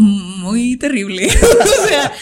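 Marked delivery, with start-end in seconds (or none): 1.3-1.8 high, loud laugh; a sudden high laugh; burst of laughter. Real, not acted. Laughs at bad experience.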